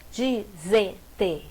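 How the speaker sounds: slow disenteria, di-sen-te-ri-a with pauses; syllable by syllable. Shown as a demonstration. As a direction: split disenteria into five separate syllables. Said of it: "como separa".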